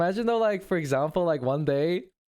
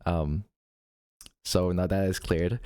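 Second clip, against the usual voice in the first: much lower, laid-back guy apologizing; apology video dude; 7 semitones lower than usual. Pretend. Pretend canceled streamer apologizing to fans, deep dude voice. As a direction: shrug it off in a deep bro voice. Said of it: "I make an apology video".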